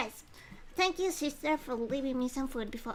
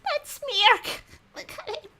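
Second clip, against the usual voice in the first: high, squeaky with laughter; laughing; 10 semitones higher, 8 dB louder, slower than usual. Real, not acted. Streamer reacting to a character's smirk, laughing. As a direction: squeeze the words out through a laugh.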